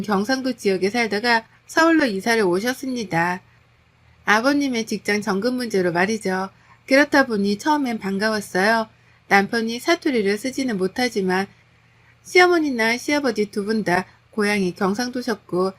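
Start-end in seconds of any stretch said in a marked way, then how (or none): none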